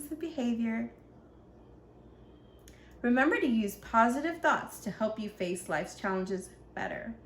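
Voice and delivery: ordinary delivery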